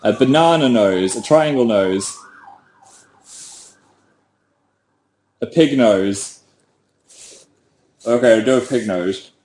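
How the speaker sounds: talking round a pen held in teeth; words muffled by a pen between the teeth; pen in mouth. Real, not acted. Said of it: "I can't do the mouth thing anymore".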